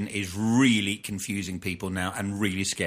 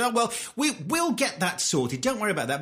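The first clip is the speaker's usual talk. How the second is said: lightly mocking smug minister, pitched up; 9 semitones higher than usual. Retelling a minister brushing off worried residents, smug. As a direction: imitate breezy, smug reassurance. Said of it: "they were just so smug about it".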